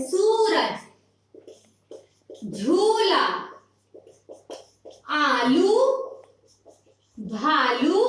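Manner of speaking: सूरज, झूला, आलू, भालू with u stretched and stressed; stressed long vowel. Shown as a demonstration. Teacher dictating long-u words to children, each u stressed and stretched. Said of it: "जिस पर जोर देकर".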